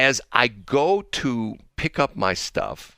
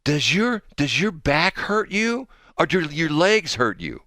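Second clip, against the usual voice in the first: high, slow, concerned questioning; lady impression; 8 semitones higher, slower than usual. Host voicing the lady asking about his health, mock-concerned high voice. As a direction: mimic a solicitous stranger, stretching the words. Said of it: "And she says".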